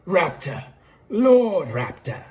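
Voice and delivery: bad Australian accent, stretching LORD; attempted Australian accent. Lord Raptor correcting Phoenix Wright, hammed-up Australian accent.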